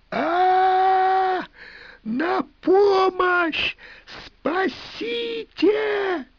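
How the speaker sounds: high, slow, drawn-out hysterical screams; hysterical screaming. A narrator's reading. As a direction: scream for help in panic. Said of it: "истерично закричала она".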